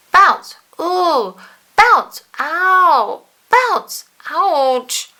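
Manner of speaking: loud high yelps between bounces; bounce and ouch cries. A narrator's reading. Mister Bounce hit by tennis rackets, loud high yelps. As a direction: yelp each time the racket hits.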